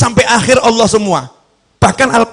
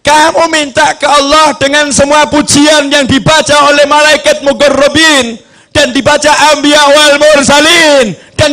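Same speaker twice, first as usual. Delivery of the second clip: raised, ringing sermon delivery; impassioned preacher in full flow; full-force preaching; 6 semitones higher, 5 dB louder than usual. Acted. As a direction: drive each phrase home to the crowd.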